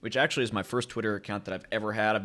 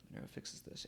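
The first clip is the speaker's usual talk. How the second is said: breathy whispered aside; 19 dB quieter than usual. Shown as a demonstration. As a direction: whisper it like a secret. Catchphrase repeated in a whisper.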